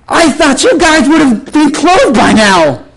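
high, drawn-out mocking impression; condescending woman. Annoying woman to pastor about his church, condescending. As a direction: put on her voice, high, slow and tactless.

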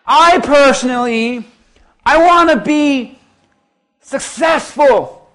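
high, slow, loud sneer on successful; slow mocking impression. Preacher imitating the woman's boast slowly to the congregation. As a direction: stretch it out like her smug boast. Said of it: "And she was like".

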